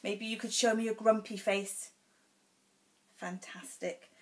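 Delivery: usual speaking voice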